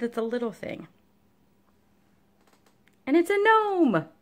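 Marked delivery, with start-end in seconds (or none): 3.1-4.1 high, slow and loud on gnome; excited reveal. Real, not acted. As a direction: unveil the gnome with delight. Proudly revealing her gnome.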